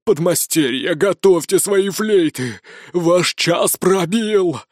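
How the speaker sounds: slow, building to a shout; villain's rallying cry. A narrator's reading. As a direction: call the apprentices to war. Villain rallies apprentices to battle.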